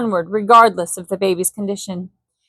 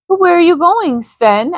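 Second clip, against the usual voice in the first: worried Jane; 8 semitones higher than usual. Acted. Jane anxiously questions Anderson.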